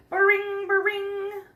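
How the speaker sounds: high, loud mock ringing; phone ring. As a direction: ring like a telephone.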